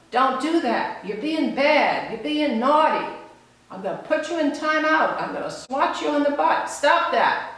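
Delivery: scolding adult. Retold or imitated. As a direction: imitate a grown-up threatening a naughty child. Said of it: "What the child gets from".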